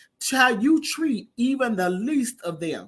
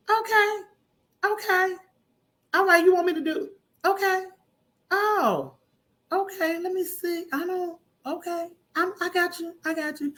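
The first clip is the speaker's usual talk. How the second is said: pitched up, eager voice on the phone; pitched-up eager phone voice, higher than her own; acted phone call; 8 semitones higher than usual. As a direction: play the flattered caller saying yes to everything.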